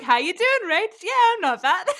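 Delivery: high, loud chirpy fake-fine voice; hammed-up pretending all is well; mock breezy cover-up. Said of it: "And then I'm like".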